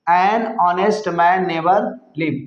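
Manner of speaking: very slow, word by word; slow dictation. Shown as a demonstration. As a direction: read it out slowly for students to copy. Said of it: "reading the translation slowly". (बहुत धीरे, शब्द-शब्द करके पढ़ें)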